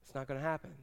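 low, soft resignation; resigned doubt. Acted. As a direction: give up quietly, voice dropped. Acted prayer giving up hope.

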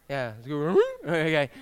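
usual speaking voice

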